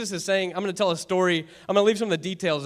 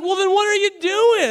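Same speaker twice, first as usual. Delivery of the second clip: high, loud incredulous question; hammed-up baffled outcry; mock exasperation; 12 semitones higher, 7 dB louder than usual. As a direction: imitate someone at a total loss.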